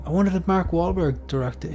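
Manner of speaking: ordinary delivery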